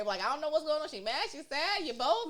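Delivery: high, loud, hammed-up confusion; mock bewilderment. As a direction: play someone thrown by an emotional outburst. Acts out confusion at crying outburst.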